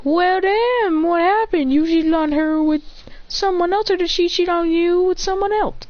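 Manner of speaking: high-pitched, acted disbelief; pry with exaggerated surprise, voice held up high; mock astonishment. Roommate asks about a supposed breakup, high mock astonishment.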